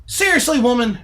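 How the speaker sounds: high, loud exasperated cry; exasperated shout. Real, not acted. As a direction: throw up your hands, yelling. Exasperated outburst.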